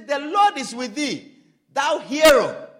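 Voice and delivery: loud, ringing call to a hero; ringing proclamation. Acted. Preacher booming the angel's greeting to Gideon, loud. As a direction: hail him like a herald. Alternate version, proclaimed louder.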